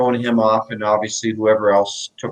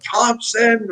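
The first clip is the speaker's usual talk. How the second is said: high mock yell of the coach's name; 11 semitones higher than usual. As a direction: bark the surname like an angry coach across the bench. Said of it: "Brian Murray was screaming at me".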